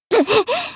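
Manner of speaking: quick high-pitched giggle; short high laugh. Acted. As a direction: let out a small, high laugh. Laugh sound between game lines. (高い声の短い笑い)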